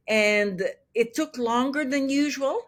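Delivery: normal talking voice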